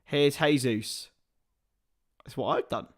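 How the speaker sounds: usual speaking voice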